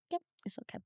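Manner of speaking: whispered to herself; whispered aside. Real, not acted. Guest checking her lip gloss, whispered aside. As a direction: breathe it under your breath. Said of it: "Can I just check if my lip gloss is okay?".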